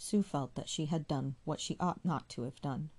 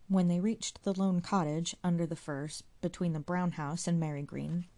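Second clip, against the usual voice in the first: plain reading voice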